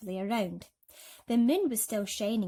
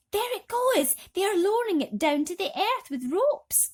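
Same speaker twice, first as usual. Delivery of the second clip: loud, much higher exclaim; excited James; 12 semitones higher, 8 dB louder than usual. A narrator's reading. James crying out to the others as it goes. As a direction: point out the lowering rainbow.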